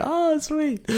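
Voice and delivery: high, airy mock approval; chill crowd voice. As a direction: imitate a relaxed festival crowd. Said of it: "Everyone's like".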